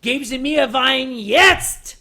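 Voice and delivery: insist, drawing it out slowly; pushier German order. Shown as a demonstration. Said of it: "I know how to say".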